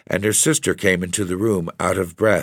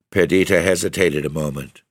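plain reading voice

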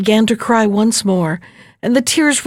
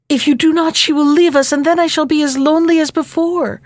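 raised, higher-pitched plea; urgent pleading; 6 semitones higher, faster than usual. A narrator's reading. Princess urging her father to marry the countess, urgent pleading. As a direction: press the case in a child's anxious high voice.

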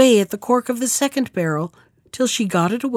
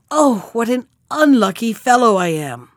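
mild woeful cry. A narrator's reading. Carter seeing his old enemy, crying out mildly in woe. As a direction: cry out with understated dismay. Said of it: "the carter cried out".

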